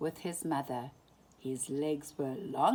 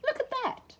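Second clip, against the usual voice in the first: put-on high, amazed child voice; excited wonder; 4 dB louder, faster than usual. A narrator's reading. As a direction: point it out in wonder. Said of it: "said Bambi".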